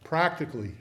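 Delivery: ordinary talk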